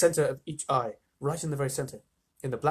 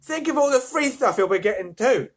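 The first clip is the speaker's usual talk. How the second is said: loud, higher envious call from the crowd; 9 semitones higher, 6 dB louder than usual. A narrator's reading. Onlooker to the boy with the winning ticket, envious. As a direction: call it out with envy. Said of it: "somebody said enviously".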